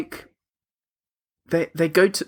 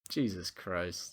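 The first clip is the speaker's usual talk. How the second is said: exasperated mutter; 5 semitones lower, 17 dB quieter, slower than usual. Retold or imitated. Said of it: "I was like".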